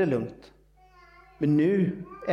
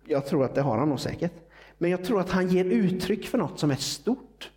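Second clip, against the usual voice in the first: ordinary talk, nothing marked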